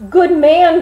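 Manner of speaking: high, loud frightened plea; alarmed cry for help. A narrator's reading. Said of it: "cried he".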